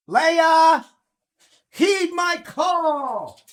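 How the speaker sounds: loud, high, drawn-out summons; calling out. Real, not acted. Owner summoning the corgi Leia, grand calling out. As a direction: holler it grandly, stretching the last word.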